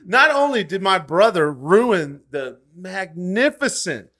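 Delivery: high, loud indignant protest; mock outrage. Real, not acted. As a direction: defend the car like it was sacred. Defending his beloved old car.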